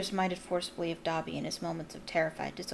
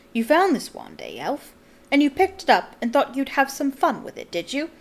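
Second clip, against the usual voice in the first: louder, higher accusing wizard; stern official; 5 semitones higher, 5 dB louder than usual. A narrator's reading. Diggory accuses the cowering elf, stern and scornful.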